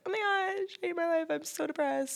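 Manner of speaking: high, soft whiny moping; mock whiny self-pity. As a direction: mope like a sulky teen. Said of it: "we were always like".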